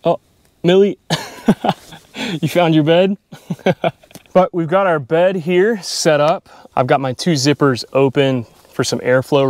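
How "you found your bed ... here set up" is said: ordinary talk, nothing marked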